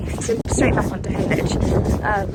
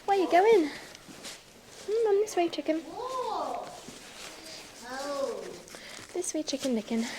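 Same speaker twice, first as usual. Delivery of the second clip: pet talk; 7 semitones higher, 5 dB quieter than usual. Real, not acted. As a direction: coax the pony along sweetly. Coaxing horse along.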